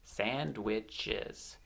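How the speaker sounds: "Sandwiches" quietly split into three beats; syllable by syllable. Shown as a demonstration. Teacher showing children how to count, syllable by syllable. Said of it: "clap the syllables".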